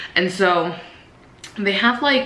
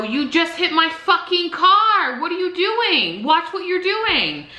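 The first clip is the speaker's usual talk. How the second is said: high, slow indignant telling-off; angry retort; 11 semitones higher, slower than usual. Retold or imitated. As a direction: scold her for hitting the car. Confronting driver who hit car.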